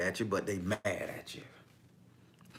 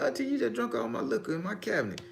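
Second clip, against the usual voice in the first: whiny complaint; faster than usual. Retold or imitated. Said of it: "I be like".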